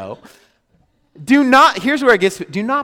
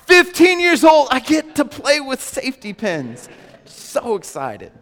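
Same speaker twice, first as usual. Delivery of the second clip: mock excited teen; 7 semitones higher, 3 dB louder than usual. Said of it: "mock teenager milestone".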